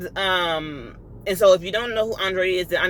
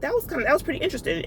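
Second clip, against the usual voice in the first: quick musing; 5 semitones higher, much faster than usual. Retold or imitated. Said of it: "I was like".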